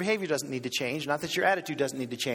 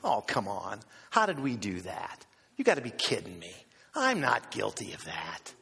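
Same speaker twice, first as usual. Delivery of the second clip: low, mock-incredulous protest; acted refusal of blame, low in pitch; dismissive denial; 9 semitones lower than usual. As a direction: scoff off the accusation. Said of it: "the wrong response is".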